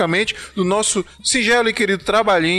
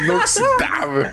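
much higher, laughing and drawn out; high mock praise; slower than usual. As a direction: squeal it like a joke. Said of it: "Foi inoxidável".

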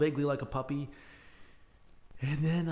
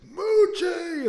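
loud high call across the yard; 11 dB louder than usual. Retold or imitated. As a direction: shout the dog's name from afar. Neighbor calling runaway dog.

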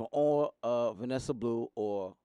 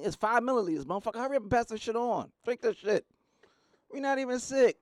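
pushy urging; 9 semitones higher, 4 dB louder than usual. Retold or imitated. Dosing kids, hurrying them.

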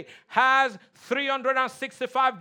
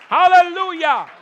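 loud high hallelujah, full voice; shouted praise; 7 semitones higher, 12 dB louder than usual. Real, not acted. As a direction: throw it out to the whole church. Preacher calls out praise.

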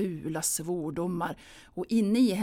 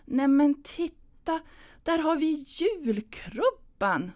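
slow, higher, easy cheer; glad and simple; 9 semitones higher, 4 dB louder, slower than usual. Pretend. Anna rehearsing a cheerful line: slow, higher, gentle cheer. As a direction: point it out brightly, nice and easy. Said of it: "säga det glatt och enkelt".

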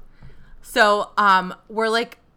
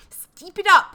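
"Steep it up" with step stretched to steep, higher and louder; mock British; 10 semitones higher, 12 dB louder than usual. Mock British judge telling the queens to "Steep it up". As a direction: squeal "Steep it up" in a hammed-up British twang. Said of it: "we gotta step it up".

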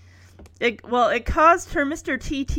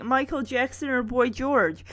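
ordinary talk, nothing marked

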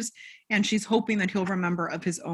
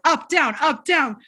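higher and louder barked commands, mocking; bossy instructor; 6 semitones higher, 6 dB louder than usual. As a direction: call out each move like a pushy drill leader.

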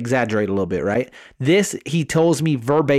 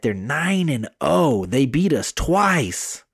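ordinary delivery

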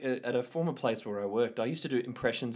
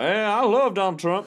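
mock Trump fan; 9 semitones higher, 9 dB louder than usual. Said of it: "he wasn't like".

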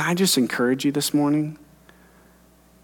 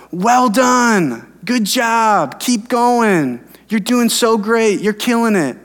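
cheering encouragement; 8 semitones higher, slower than usual. Retold or imitated. God cheering the overworked pastor on from heaven, high excited cheer. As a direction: cheer him on like a coach from the stands. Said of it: "God was up there in heaven screaming at me".

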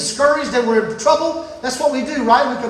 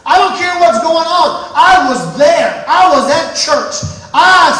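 louder declaration; 6 semitones higher, 8 dB louder than usual. Retold or imitated. Thomas's faith voiced louder.

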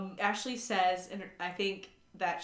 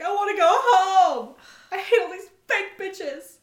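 much higher and louder, laughing through a whiny fit; hammed-up tantrum, half laughing; mock tantrum; 11 semitones higher, 11 dB louder than usual. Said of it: "Melissa starts freaking out".

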